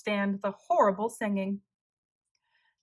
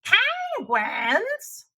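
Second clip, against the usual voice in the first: much higher, rising innocent question; mock surprise; 11 semitones higher than usual. Tacky plays dumb to the hunters, surprised. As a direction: act puzzled, playing dumb. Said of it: "said Tacky".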